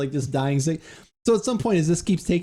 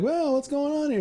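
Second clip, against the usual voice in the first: put-on old farmer doctor; 12 semitones higher than usual. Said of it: "he's like".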